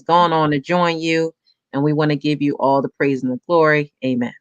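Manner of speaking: steady, earnest opening prayer. Acted. [name] praying the live in for [name], reverent prayer. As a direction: pray them in with reverence.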